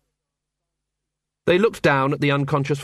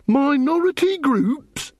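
MINORITY GROUPS slow and high, in disbelief; indignant echo; 13 semitones higher, much slower than usual. A narrator's reading. Angua throws Carrot's phrase back, indignant disbelief. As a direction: stretch "Minority groups?" out, pitched high, rising.

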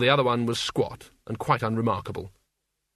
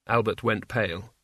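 plain reading voice

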